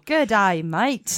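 hammed-up Australian twang; Australian accent. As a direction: put on a playful Aussie accent. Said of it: "Let's find an Australian story next".

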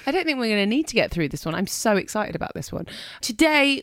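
ordinary delivery

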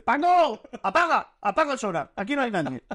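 hammed-up emergency command, starting at full pitch; urgent shouted order. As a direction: shout orders down the radio in mock alarm. Acts out urgent control-room call.

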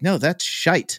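mock Irish scoff in a brogue. Irish friend dismisses the sweetened coffee, light Irish brogue. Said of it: "got a brogue to him".

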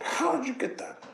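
squeaky pretend astonishment; fake mad. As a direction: playfully scold the dog in falsetto. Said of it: "fake mad".

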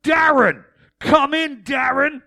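loud, high radio hailing; mock dispatcher trying hard to get through; urgent walkie-talkie voice. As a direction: shout insistently into a two-way radio. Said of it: "Then it happened more and more".

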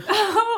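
big high burst of laughter; loud laugh. Real, not acted. Mum laughing hard at her friend's joke, high burst. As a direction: crack up loudly.

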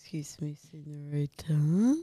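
whisper. Acted. Teasing sultry aside.

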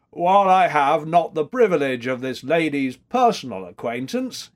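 loud formal announcement; pompous announcement; announcing. A narrator's reading. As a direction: proclaim it to the room like a master of ceremonies. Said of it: "he announced".

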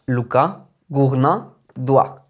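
slow, deliberate French pronunciation; French accent. Shown as a demonstration. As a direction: say the name slowly with a French accent. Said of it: "θέλω να το πω λίγο με προφορά". (γαλλική προφορά, αργά)

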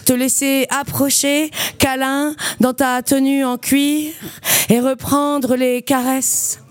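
intense spoken-word performance; slam poetry. Acted. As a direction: recite it like a slam poem on stage. Performs her slam poem.

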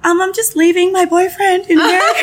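high, loud hammed-up crying voice; mock tearful wail. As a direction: wail it like you just burst into tears. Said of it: "I, like, burst into tears".

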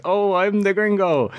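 dawning realization. Retold or imitated. Realizing he is the target.

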